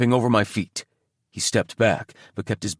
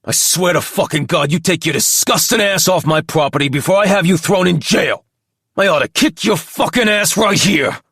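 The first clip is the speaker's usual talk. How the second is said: loud, higher enraged yelling; furious threat; 7 semitones higher, 5 dB louder than usual. A narrator's reading. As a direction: spit the threats out in a rage. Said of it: "as he spat in my face".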